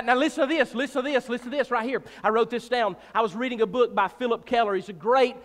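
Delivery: normal talking voice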